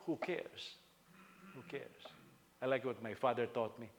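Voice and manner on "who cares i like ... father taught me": ordinary talk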